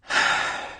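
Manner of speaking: soft unvoiced sigh; boy sighing in resignation; weary sigh. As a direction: let out a tired sigh. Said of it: "Jonas sighed".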